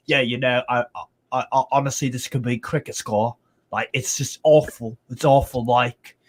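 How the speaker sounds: mock Leeds fan in a bad Yorkshire accent; failed Yorkshire accent impression; terrible Yorkshire accent. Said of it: "that was terrible Yorkshire accent".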